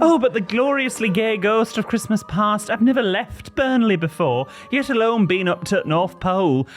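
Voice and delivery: high, camp and startled; startled camp boy. Acted. Camp boy waking to a ghost, high and startled. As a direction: squeal in surprise like a camp lad.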